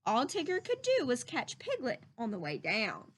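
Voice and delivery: usual speaking voice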